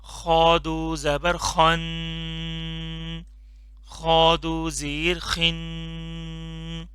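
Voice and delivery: very slow, drawn-out drill for repeating; slow drilled recitation. Shown as a demonstration. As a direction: stretch each syllable for the class to echo. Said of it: "इसको भी मोटा करके पढ़ना है". (बहुत धीमे, खींचकर पढ़ाना)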